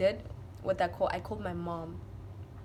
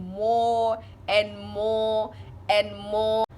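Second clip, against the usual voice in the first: each "more" stretched long; drawn-out emphasis; 4 dB louder, much slower than usual. Retold or imitated. Telling how she cried explaining to mom, drawn-out mock wail. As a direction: drag out every "more" on a held note. Stretching words to show growing crying.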